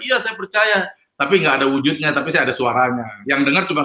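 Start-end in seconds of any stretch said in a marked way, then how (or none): 0.0-0.8 high-pitched retold cry, not truly shouted; mock yell in a raised pitch. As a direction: imitate crying out, pitch jumping up without added volume. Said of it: "Saya teriak-teriak".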